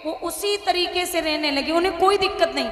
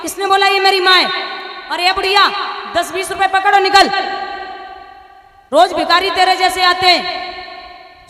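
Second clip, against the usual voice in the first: contemptuous shout; 4 semitones higher, 7 dB louder than usual. Acted. Son disowns mother, shouting.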